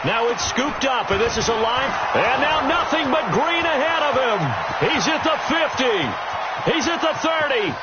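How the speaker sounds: rising excited play-by-play yell; touchdown call. Real, not acted. Blocked kick returned for score.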